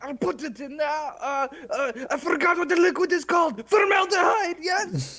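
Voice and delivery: excited shout. Acted. Character excited about trophy.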